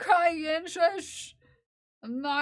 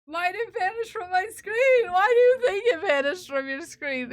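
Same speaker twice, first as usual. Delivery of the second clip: higher, louder mock whine; 5 semitones higher, 6 dB louder, faster than usual. Streamer whining at chat over vanished screen, mock whine. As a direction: whine it like a wronged child.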